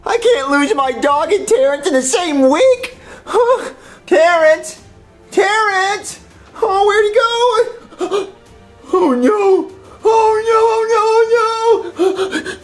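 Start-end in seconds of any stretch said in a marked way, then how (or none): 3.2-8.3 high, whiny wail; drawn-out, tearful whimper; distraught wailing. Acted. Panicking over missing pet. 8.9-12.6 high, whimpering cry; hammed-up tearful panic; despairing wail. Despair over lost pet.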